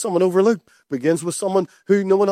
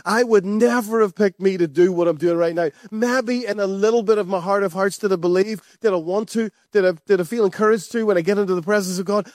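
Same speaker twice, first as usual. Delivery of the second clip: ordinary delivery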